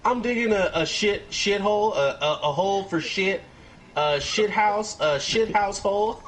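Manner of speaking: high, stammering nervous voice; flustered Dale. Retold or imitated. Dale stammering to the girl, high and flustered. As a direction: fumble for the word in panic. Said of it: "He's like".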